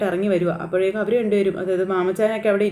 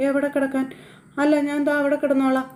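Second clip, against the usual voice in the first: higher, lightly voiced young girl; little girl voice; 6 semitones higher than usual. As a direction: pitch up as the little granddaughter. Child Krishna talks to grandmother. (ചെറിയ കുട്ടിയുടെ ശബ്ദം)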